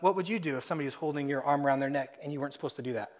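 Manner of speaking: ordinary talk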